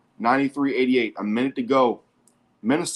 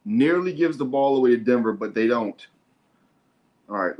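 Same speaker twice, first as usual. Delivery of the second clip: ordinary delivery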